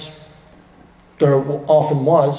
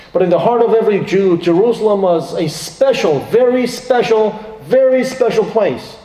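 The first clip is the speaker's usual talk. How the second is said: higher, building emphasis on very special; rising preaching fervor; 5 semitones higher than usual. Acted. Preacher stirring the church about Jerusalem, rising fervor. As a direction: preach it with mounting passion.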